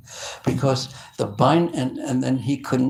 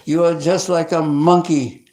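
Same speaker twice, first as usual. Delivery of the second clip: loud, higher exasperated scolding; a mother fed up with her naughty son; 4 semitones higher, 6 dB louder than usual. Acted. Mother Yasoda scolding little Krishna, loud exasperated scolding.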